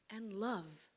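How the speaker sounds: ordinary talk, nothing marked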